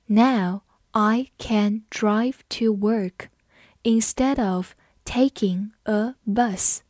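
slow and measured, separating the words; slow read-along. Shown as a demonstration. Teacher modelling the bus sentence for students, slowly. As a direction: give learners time on every word. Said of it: "好，我们慢读一遍".

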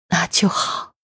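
faint whispered breath; barely voiced, last strength; dying words. A narrator's reading. Dying mother answers the neighbours. (临终微弱气声)

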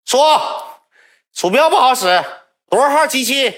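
high, loud call across the cafe; calling the attendant. Retold or imitated. Boy shouting for the attendant downstairs, loud and high. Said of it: "我一喊网管".

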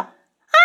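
thin frightened scream. A narrator's reading. 嫣儿 suddenly wakes and screams in fright. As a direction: cry out in sudden terror. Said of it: "继续尖叫".

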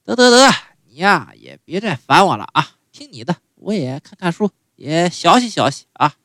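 mild impression of an impatient mom; lightly voiced mother, brushing him off; a hint of a weary old mother; mother giving in. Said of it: "我妈也说了".

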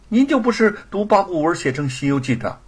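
put-on high, bright boyish reply; a quick-witted boy answering his father; clever child. 孩子机灵地回答. (孩子机灵地对爹爹说)

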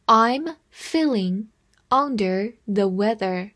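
slow, phrase-by-phrase reading. Shown as a demonstration. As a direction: read it slowly in two chunks. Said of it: "慢读一遍". (慢读一遍)

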